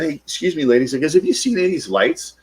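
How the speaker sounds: high-pitched, non-threatening polite query; careful polite approach. Retold or imitated. Sales guy approaching ladies at the gas pumps, careful and polite. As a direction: pitch it high so nobody gets alarmed. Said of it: "I didn't want to freak them out".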